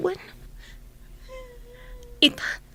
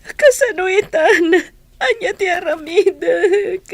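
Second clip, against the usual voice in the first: slow, loud, higher words broken by sobbing; sobbing despair; 5 semitones higher, 6 dB louder, slower than usual. Acted. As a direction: cry out helplessly. Lost after father's death. (agsangsangit a nadanagan)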